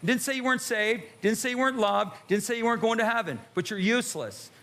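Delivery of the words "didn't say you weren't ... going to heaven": ordinary delivery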